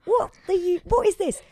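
high, loud baffled outburst. Retold or imitated. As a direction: blurt it out in confusion. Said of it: "And I went".

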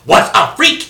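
"Was a freak" punched loud and high; stage chant of "Was a freak". Shown as a demonstration. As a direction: belt "Was a freak" as the accent. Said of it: "the only variation metrically is".

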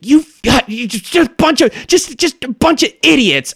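high, loud scolding yell; yelling insults. Acted. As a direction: shout down at the bullywugs. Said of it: "she yells at the bullywugs".

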